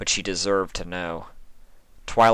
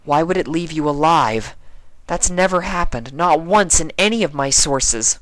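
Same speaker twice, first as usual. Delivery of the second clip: put-on higher, earnest young mare voice; anxious scholar pony pleading; Twilight, worried; 8 semitones higher, 4 dB louder than usual. A narrator's reading.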